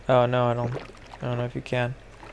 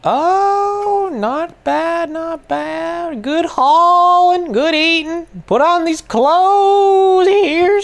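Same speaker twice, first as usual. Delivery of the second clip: much higher, slow, hammed-up character voice; silly put-on voice; 11 semitones higher, slower than usual. Gamer voicing a fussy caretaker dressing his character, hammed-up high. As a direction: croon at her like a fussy old caretaker.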